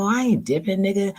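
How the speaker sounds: refusing to back down; defiant. Retold or imitated. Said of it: "Here I go".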